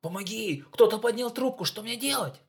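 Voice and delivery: high, loud frantic pleading; panicked cry. Acted. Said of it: "в панике кричит".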